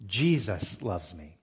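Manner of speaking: "Jesus" drawn out and set apart, "loves me" trailing; stress on Jesus. Shown as a demonstration. Preacher demonstrating emphasis to the congregation, stress on "Jesus". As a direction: lean slowly on the word "Jesus". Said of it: "emphasizing a different word each time".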